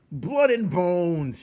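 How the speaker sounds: high, loud, slow rat yell; rat shout. A narrator's reading. A hungry rat shouts about the mouse. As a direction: bellow it out hungrily. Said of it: "shouted another".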